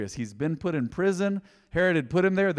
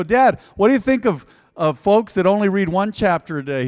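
loud, higher call down a hallway; calling out to Dad; 5 semitones higher, 9 dB louder than usual. Retold or imitated. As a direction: raise your voice to catch Dad leaving.

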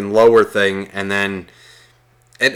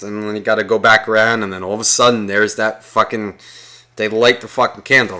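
ordinary talk

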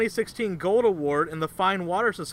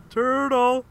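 raised, louder mock catchphrase; movie catchphrase impression; 4 semitones higher, 3 dB louder than usual. Quoting the turtle scene to a Yelp caller, mock catchphrase. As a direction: act out the turtle line, on the edge of laughing. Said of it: "So I went".

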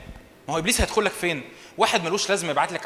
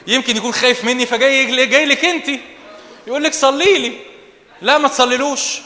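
high, loud mocking build; excited mockery; 6 semitones higher, 7 dB louder than usual. Real, not acted. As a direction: get worked up, laughing at it.

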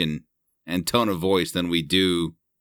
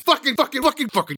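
much higher squealed repeats; high squeaky swearing; faster than usual. Acted. Swearing at a jerk in a high squeal. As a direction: squeal the word over and over.

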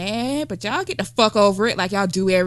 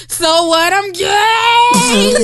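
high, loud defiant declaration; Empire character shout; 10 semitones higher, 8 dB louder, much slower than usual. Retold or imitated. Friend suggests a comeback line, loud defiant shout. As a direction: belt it out proudly and defiantly. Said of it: "Like old boy from Empire".

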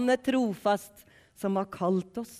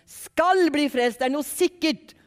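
loud, higher burst of preaching; emphatic proclamation; 5 semitones higher, 7 dB louder than usual. Real, not acted. Preacher assuring listeners of salvation, forceful raised voice. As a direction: proclaim the promise with a stretched, forceful start.

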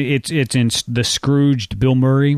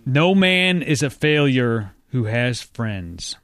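slow dramatic reading; 3 semitones lower, much slower than usual. Real, not acted. Reading quiz quote slowly.

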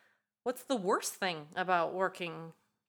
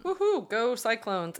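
excited cheer; 3 semitones higher, 6 dB louder than usual. Real, not acted. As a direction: cheer like a fan in the stands. Cheering for son's college team.

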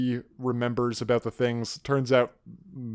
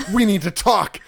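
stern order; 6 semitones higher, 7 dB louder than usual. Retold or imitated. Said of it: "Xander's like".

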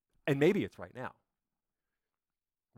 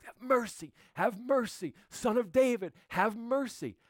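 mock desperate crying out; 8 semitones higher than usual. Preacher voicing the blind men begging Jesus, high pleading cry. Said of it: "They are screaming at the top of their lungs".

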